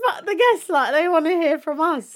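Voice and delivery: high, loud, bratty; mock diva. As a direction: play it like a spoiled star. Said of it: "We were like".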